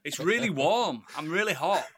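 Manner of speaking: talking through real laughter; laughing. Real, not acted. Host owning up to being overheated, laughing. Giggling over feeling hot.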